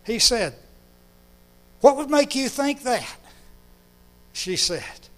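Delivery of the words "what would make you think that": puzzled question. Retold or imitated. Father puzzled by daughter.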